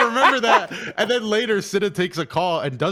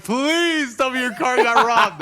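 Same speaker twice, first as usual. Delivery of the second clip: slow and high, laughing through the words; can barely get it out for laughing; 9 semitones higher, 5 dB louder, much slower than usual. Retold or imitated. Friend on call, laughing at the car news. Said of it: "I started laughing immediately".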